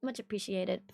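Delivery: sarcastic thanks. Retold or imitated. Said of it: "And I'm like".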